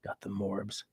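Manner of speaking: muttered trial. Shown as a demonstration. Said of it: "I gotta fit that into conversation".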